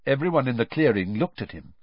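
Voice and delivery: usual speaking voice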